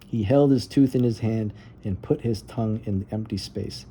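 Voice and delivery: usual speaking voice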